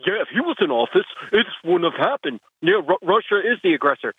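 lightly voiced clueless Trump supporter; a hint of a dopey voter; dumb guy voice. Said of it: "you did an impression of a dumb guy".

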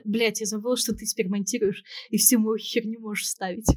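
ordinary delivery

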